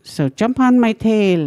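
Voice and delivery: a hint of a cheerful otter calling out; mock otter offering a ride; otter's offer. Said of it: "the otter told".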